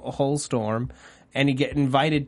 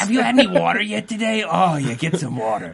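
goofy inner monologue nagging himself; Adam Sandler-ish voice; 3 semitones higher than usual. Retold or imitated. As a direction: babble like a goofy Sandler character. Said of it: "It's Adam Sandler".

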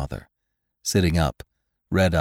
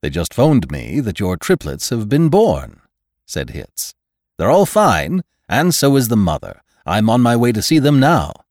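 bright, loud, bustling doctor; cheerful hurried official; brisk doctor voice; 7 semitones higher, 9 dB louder than usual. A narrator's reading. Hitz voiced by reader.

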